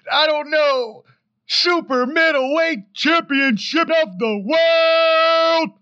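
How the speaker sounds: high, loud and slow, building to a shout; hammed-up boxing announcer stretching every title; mock ring announcer. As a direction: parody title-fight announcement swelling to a shout.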